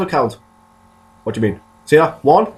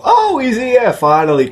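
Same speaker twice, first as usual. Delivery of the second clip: much higher, hammed-up glad cry; mock tribe welcome; 11 semitones higher than usual. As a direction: play the tribe finally spotting him. Tribe spotting him at last.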